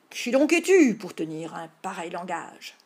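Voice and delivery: theatrical knightly demand; loud challenge. Acted. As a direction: boom out the memorised line. Line repeated aloud after prompt.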